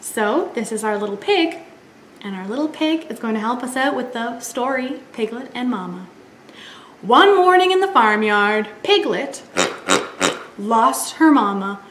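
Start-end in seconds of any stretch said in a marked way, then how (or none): none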